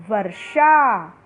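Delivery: वर्षा said slowly, the र before षा clear; slow teacher reading. Shown as a demonstration. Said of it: "तो किस तरह से बोलना है?".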